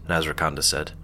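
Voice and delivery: ordinary delivery, nothing marked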